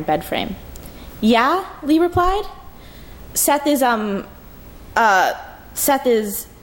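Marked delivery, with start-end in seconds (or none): 1.2-2.4 younger sister calling. Acted. Lee replies from the bathroom. 3.3-6.5 hesitant, halting start over again; stuttering. Acted. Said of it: "Elle stuttered".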